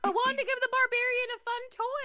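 high, loud playful protest. Real, not acted. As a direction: plead your case over the teasing. Protesting over the teasing accusation.

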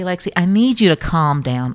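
normal talking voice

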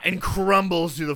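ordinary talk, nothing marked

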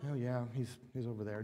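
quiet, casual brush-off; offhand shrug. Retold or imitated. Preacher voicing an indifferent God, quiet and offhand. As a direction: toss it away like it barely matters.

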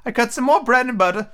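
high, fretful Hatter; nervous Hatter voice. Acted. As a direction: plead it high and thin.

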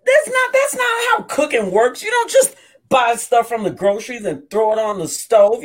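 mock outraged wife; wife's rant. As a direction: push back hard, escalating. Wife ranting about cooking.

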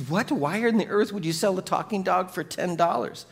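high-pitched incredulous "What?" going up sharply; disbelief. Retold or imitated. Buddy baffled that a talking dog costs ten bucks, incredulous. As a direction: imitate a man who can't believe the price. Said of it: "He's like".

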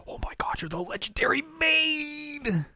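high, slow breathless whisper; awed whisper. Retold or imitated. The girls meet the famous maid in a high, slow, awed whisper. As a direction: gasp it like starstruck fans. Fans gushing over the maid.

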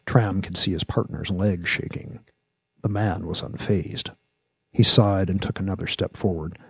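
ordinary delivery

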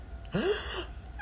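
high, soft gasp of amazement; astonished intake of breath. Acted. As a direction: suck in a breath at big news. Reacts to the story's reveal.

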